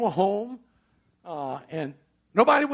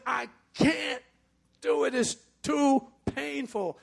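pained refusal; 10 semitones higher, slower than usual. Retold or imitated. Wife pleading in pain.

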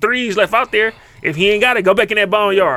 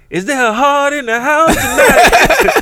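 slow, higher, louder hype-man call through laughter; hype announcer; 9 semitones higher, 4 dB louder, much slower than usual. Retold or imitated. Teasing his brother on the couch, mock hype-man call. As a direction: call out to the room like a club MC. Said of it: "I'm sitting in that motherfucker's like".

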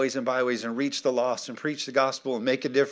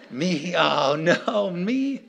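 rising to a high, incredulous squeak; mock disbelief; 4 semitones higher, much slower than usual. Moses stunned that God chose him, mock disbelief. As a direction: climb in comic alarm to the last word.

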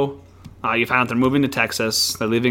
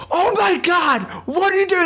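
high mock shout of alarm; hammed-up high startled yell; mock shocked shout. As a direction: yell it high like you walked in on something. Crew shouting at Miz.

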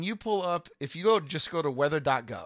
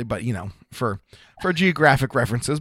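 ordinary talk

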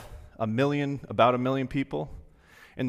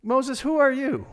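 high, sharp call to Moses; God rebuking; 13 semitones higher, 4 dB louder than usual. Retold or imitated. God answering Moses's complaint. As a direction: challenge him from above. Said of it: "God is saying".

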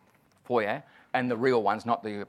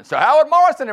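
big, higher, louder MC intro; stage announcement; 13 dB louder than usual. Retold or imitated. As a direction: boom out the name like a compere.

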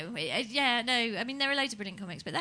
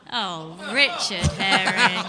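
laughing reproach; 2 semitones lower, 8 dB louder, much slower than usual. Real, not acted.

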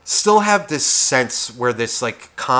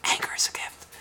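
sudden drop to a hushed whisper; 10 dB quieter than usual. Acted. Whisperer finally delivering the message up close, whispered. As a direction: whisper it after all the shouting. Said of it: "I fucking was trying to whisper".

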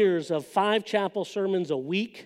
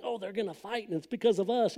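much softer, hushed worry; hushed aside; 8 dB quieter than usual. Retold or imitated. Kids hushing each other about a fight.

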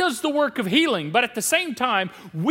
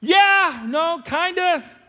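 wavering; 6 semitones higher, 6 dB louder, much slower than usual. Retold or imitated. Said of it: "It's like".

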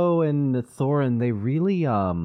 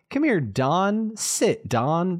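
higher, drawn-out mock dog command; calling a dog; 7 semitones higher, much slower than usual. Acts out calling a pet named like himself, mock dog-owner voice. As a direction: call the dog over in a high, slow voice.

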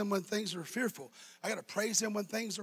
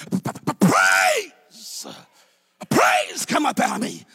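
stumble, then explode with joy; stuttered praise; 10 semitones higher, 11 dB louder, slower than usual. Retold or imitated. Pastor retelling his stuttering praise under attack, stutter bursting loud. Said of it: "as I was a kid and I stuttered".

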